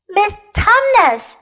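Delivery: high, loud bawl of a name. A narrator's reading. Lucy bawls in the sobbing faun's ear, loudly. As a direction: bawl it to get through to someone. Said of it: "bawled Lucy in his ear".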